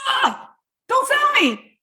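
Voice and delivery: high, slow, put-on voice pushing a seller away; defensive recoil. Shown as a demonstration. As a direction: act like a wary shopper fending off a pitch. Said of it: "when I'm instantly like this".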